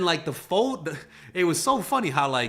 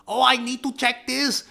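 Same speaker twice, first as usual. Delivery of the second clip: mock landlord; 10 semitones higher, 4 dB louder than usual. Landlord's excuse, voiced.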